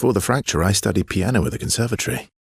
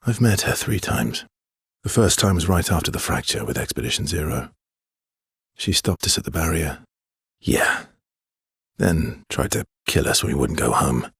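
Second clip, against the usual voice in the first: ordinary talk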